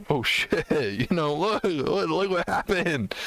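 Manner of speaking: high-pitched, excited hillbilly drawl. Retold or imitated. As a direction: play an amused hillbilly showing off the damage. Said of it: "The hillbilly kicks in".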